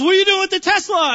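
high, loud startled cry of the Tesla owner. Retold or imitated. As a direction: blurt it out like an owner catching thieves in his garage. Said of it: "the owner goes".

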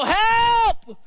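long, high, loud plea; crying out to God. Acted. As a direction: belt out a drawn-out cry for help. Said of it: "simply cry out to God".

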